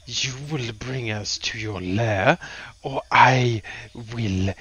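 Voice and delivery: slow, menacing character voice; intimidating dragon threat. Acted. Tyraxus squeezing a kobold's head, slow intimidation. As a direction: draw the threat out slowly, with weight. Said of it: "roll me an intimidation check".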